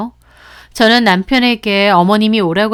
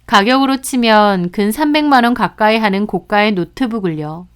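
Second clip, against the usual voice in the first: ordinary delivery, nothing marked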